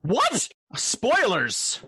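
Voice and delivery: high, hammed-up shocked protest; mock outrage. Cohost feigning shock at Lincoln spoiler, hammed-up outrage. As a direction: pretend you just got a spoiler.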